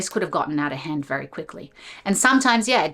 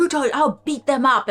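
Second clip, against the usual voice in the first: threatening; 8 semitones higher, 5 dB louder than usual. Retold or imitated. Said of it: "like, literally".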